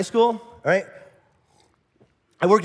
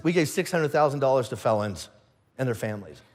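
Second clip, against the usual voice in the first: low reply, sinking softer at the end; understated answer; 6 semitones lower, 4 dB quieter than usual. Acted. Churchgoer telling people about giving to felons, low understated reply. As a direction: answer low and matter-of-fact, trailing down.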